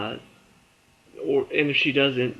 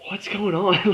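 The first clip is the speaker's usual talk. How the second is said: higher-pitched confused question; lightly acted, raised-voice disbelief; mock bewilderment; 7 semitones higher, 4 dB louder, slower than usual. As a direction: imitate someone waking up baffled. Retells Jim waking up confused.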